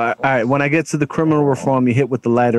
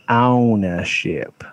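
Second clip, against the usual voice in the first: drawn-out; 3 semitones lower, much slower than usual. Retold or imitated. Dramatic reading of tweet.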